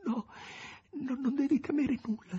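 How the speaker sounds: high, choked with tears. A narrator's reading. Margherita soothing the master through tears, high and choked. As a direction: sob the words out high. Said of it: "sussurrava con voce strozzata".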